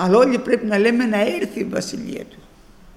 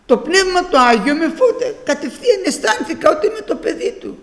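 laughing; 8 semitones higher, 3 dB louder than usual. Real, not acted. Laughing while recalling rebirth.

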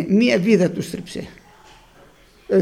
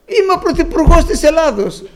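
high, loud proud declaration; patient contradicted by the visitor, shouting her claim high and loud; shouted claim; 8 semitones higher, 5 dB louder than usual. Retold or imitated. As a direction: fling the claim out, offended. Patient loses it when contradicted.

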